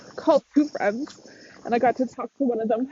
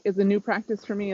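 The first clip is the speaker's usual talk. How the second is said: ordinary talk, nothing marked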